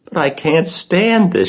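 upset, high mild shout; distressed shout. A narrator's reading. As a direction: cry out in protest, voice leaping up. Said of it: "shouted Wilbur".

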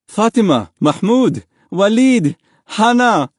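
high, loud calling of names; father crying out. A narrator's reading. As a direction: shout each name to your family. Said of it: "his father cried".

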